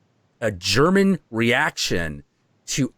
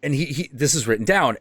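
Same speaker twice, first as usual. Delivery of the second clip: ordinary delivery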